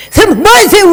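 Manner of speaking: sharp high outburst; indignant shout. Retold or imitated. Monk raising his voice at the preacher. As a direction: cry out in protest. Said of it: "소리 지르지 말고".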